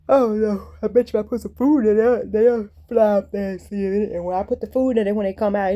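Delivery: real excitement, loud and quick; 1 loud excited rush. Real, not acted. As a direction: get loud and eager about the plan. Excited fish feeding plan.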